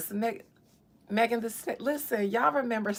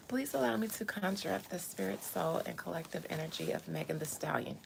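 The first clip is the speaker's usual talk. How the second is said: hushed seance voice; softly conjure the spirit; whispering; 2 semitones lower, 9 dB quieter than usual. Pretend. Conjuring again.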